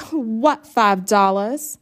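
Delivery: slow, loud questioning; suspicious surprise. A narrator's reading. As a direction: ask in suspicion.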